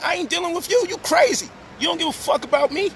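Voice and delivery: high, heated retort in a shifted voice; retold anger. Telling the woman off, high and heated. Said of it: "I'm like".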